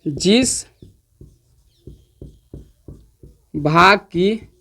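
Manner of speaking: word by word, with long pauses while writing; slow dictation. Shown as a demonstration. Teacher dictating the definition to students, slow and halting. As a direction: dictate slowly so students can copy. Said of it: "तो लिख देते हैं definition आपका".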